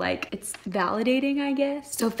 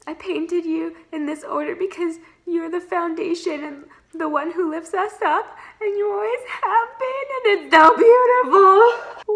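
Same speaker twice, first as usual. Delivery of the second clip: high voice building to a loud weepy wail; tearful mock wail; 9 semitones higher, 4 dB louder than usual. Reader voices sister's gift speech, overcome, tearful mock wail. Moved, acting Elaine, breaking into wail.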